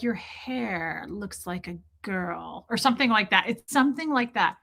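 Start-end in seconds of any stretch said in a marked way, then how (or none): 0.0-2.7 soft, slow movie line; quiet slow taunt; hushed quote. Retold or imitated. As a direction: say it low and slow. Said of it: "She's like".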